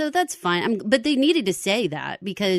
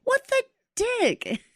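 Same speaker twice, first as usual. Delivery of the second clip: catchphrase. Shown as a demonstration. Host showing off her own catchphrase, mock disbelief. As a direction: exclaim it very high, pausing before the last word. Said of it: "that's my catchphrase".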